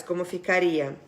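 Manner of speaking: ordinary talk, nothing marked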